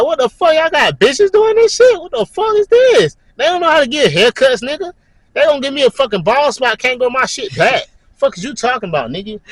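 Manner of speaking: high-pitched, outraged protest; indignant rant. Retold or imitated. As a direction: rant in comic disbelief. Acted indignant rant about haircuts.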